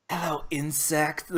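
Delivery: slow, hushed menacing AI voice; SHODAN voice. Retold or imitated. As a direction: breathe it like a cold machine villain.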